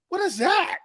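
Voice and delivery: high-pitched mock-baffled question; retold bafflement. Grandson questioning his grandfather about Italians, mock baffled. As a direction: pitch jumps right up in puzzlement.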